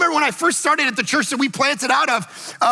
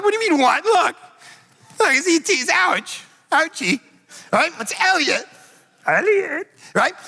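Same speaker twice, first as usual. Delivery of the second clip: high, pitched-up mock blubbering over E T; mock tearful gushing; 6 semitones higher than usual. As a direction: play up the sobbing dad, higher. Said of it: "I'm like".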